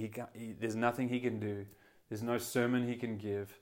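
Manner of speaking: usual speaking voice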